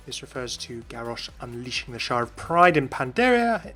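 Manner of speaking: ordinary talk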